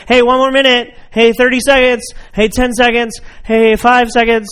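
countdown call. Retold or imitated. Parent counting down to kids at the park, loud call.

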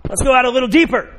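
loud high call across the boat; Jesus calling loudly. Acted. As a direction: call him out further. Jesus calls to Peter.

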